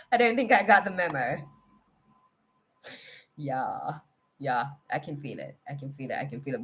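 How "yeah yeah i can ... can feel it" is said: quiet. Real, not acted. Emotional, feeling it deeply.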